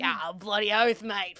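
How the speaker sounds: slow, hammed-up broad Australian accent; mock Aussie. Australian streamer jokes with her friend, hammed-up Aussie twang. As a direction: lay the Aussie accent on thick, slowly.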